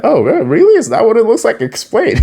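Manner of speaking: high, playful incredulity; mock teasing challenge. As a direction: tease someone caught out. Acting Karai teasing Leo.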